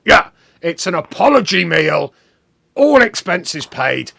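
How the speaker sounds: mock cockney boss promising a paid meal. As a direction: announce it like a generous cockney geezer. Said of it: "He's a cockney".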